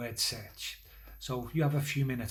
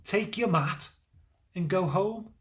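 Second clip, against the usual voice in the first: high, louder command; commanding Jesus; 7 semitones higher, 4 dB louder than usual. Acted. Jesus commanding the paralysed man to rise, high and strong. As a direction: call him to rise with authority. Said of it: "by saying to the man".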